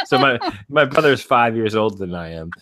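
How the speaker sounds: normal talking voice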